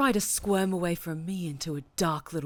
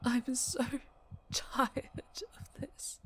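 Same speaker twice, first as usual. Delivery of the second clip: soft, higher, very slow and breathy; exhausted despair; 6 semitones higher, 6 dB quieter, much slower than usual. Acted. Captor worn down by endless conflict, weary and soft. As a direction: let it drain out of you.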